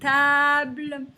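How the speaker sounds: Cartable with long a in both syllables; stretched A sound. Shown as a demonstration. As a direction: draw out each a of Cartable. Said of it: "On entend un A".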